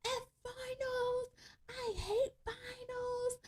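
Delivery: high, hushed mock scream. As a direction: squeal it under your breath like a drunken screamer. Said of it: "running, like, screaming".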